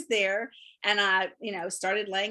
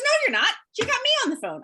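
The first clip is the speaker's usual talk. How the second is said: much higher and louder, firmly contradicting; insistent director; 12 semitones higher, 4 dB louder, much faster than usual. Retold or imitated. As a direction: cut her off with bright certainty. Director insists she's not alone.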